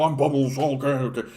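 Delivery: lightly voiced sitcom oddball; a hint of a goofy character voice; Bubbles. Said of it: "he talks like this".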